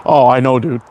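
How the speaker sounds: normal talking voice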